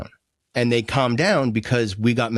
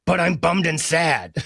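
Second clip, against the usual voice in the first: slow, higher-pitched exasperated protest; angry; 4 semitones higher, slower than usual. Acted. Unheard friend finally snapping at the helper, slow and angry. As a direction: sadness boiling over into mock anger. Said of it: "you seem angry now".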